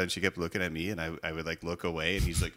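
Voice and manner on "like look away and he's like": normal talking voice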